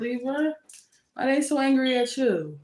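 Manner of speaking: ordinary delivery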